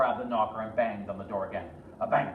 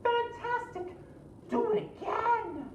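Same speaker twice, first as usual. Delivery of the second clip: very high, excited cheer; high delighted princess; slower than usual. Acted. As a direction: egg him on in a high voice. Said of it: "Elizabeth shouted".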